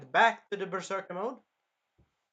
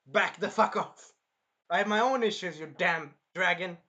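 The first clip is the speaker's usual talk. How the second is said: loud, high mock rage; pissed-off shout; 3 semitones higher, 6 dB louder than usual. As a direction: shout the dragon away, dragging out the words. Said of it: "He was, like, pissed".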